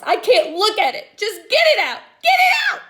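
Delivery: high, loud frantic screaming. A narrator's reading. Girl begging teacher to remove worm, screaming. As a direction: shriek it, begging it gone. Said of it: "she screamed".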